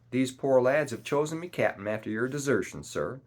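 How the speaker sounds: particular emphasis on "desertion"; stress on DESERTION. A narrator's reading. Long John answers the captain, stressing "desertion". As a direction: lean on the word "desertion". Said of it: "laying a particular emphasis on the word desertion".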